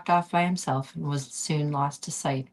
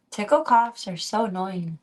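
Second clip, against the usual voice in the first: ordinary delivery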